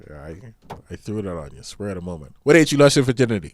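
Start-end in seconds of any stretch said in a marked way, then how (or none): 2.4-3.5 loud, fast quick-fire question; abrupt loud challenge; sudden blurted question. Real, not acted. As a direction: fire it off loudly to catch them out.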